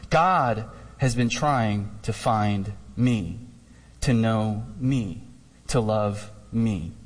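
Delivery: slow, low reading with weight on each phrase; measured, solemn and low; slow, hushed reverence. Acted. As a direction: let each phrase sink in, low and unhurried. Solemn climax of quote read aloud.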